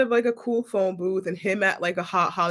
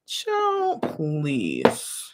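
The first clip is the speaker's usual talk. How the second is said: dismissive scoff; 3 semitones lower than usual. Real, not acted. Dismissive reaction to his message.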